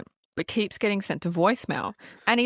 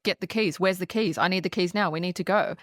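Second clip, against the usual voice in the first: usual speaking voice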